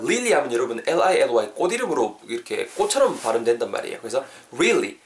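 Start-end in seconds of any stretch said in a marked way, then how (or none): 0.0-0.3 "lily" said with a clear L in front; wrong L pronunciation. Shown as a demonstration. Teacher showing learners the mistake, "lily" with an L. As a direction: say "lily" like the flower, L not R. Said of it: "lily가 아니고요".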